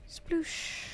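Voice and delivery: spoken splash noise with a long hushed SH; imitated splash. Player jumping into the canal, mimicking the splash. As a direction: voice the splash as a playful sound effect. Voices own jump into canal.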